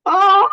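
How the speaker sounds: high, loud cry of release; loud exclaimed oh. Real, not acted. Student seeing through a big lie, loud cry of release. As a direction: let out a sudden high, loud "oh".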